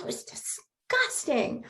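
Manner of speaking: a hushed, horrified whisper; whispered disgust. Retold or imitated. Neighbor retelling her dog's catch, whispered disgust. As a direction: whisper it like it still makes you shudder. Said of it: "She's like".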